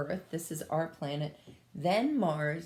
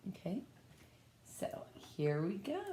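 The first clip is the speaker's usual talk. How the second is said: whisper; 9 dB quieter than usual. Real, not acted. Teacher whispers to the class before reading, hushed. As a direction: hush before the story begins. Starting the story.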